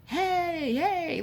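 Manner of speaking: high calling out past someone; retold yell. Old man yelling past a guest, calling out. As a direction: call out over the room, imitating an old man. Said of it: "and he's yelling".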